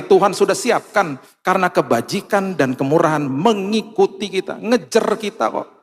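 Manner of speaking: loud, rising preacher build; preaching. Acted. Pastor rousing the congregation about God's provision, preaching. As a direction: preach it out with fervour.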